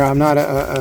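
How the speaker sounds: ordinary talk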